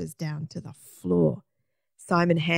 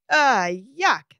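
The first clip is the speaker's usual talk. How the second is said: high, mock scream of disgust; 6 semitones higher, 4 dB louder than usual. A narrator's reading. Margaret fleeing the bathroom, disgusted scream. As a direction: squeal it in revulsion, higher and a bit louder. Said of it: "screamed Margaret".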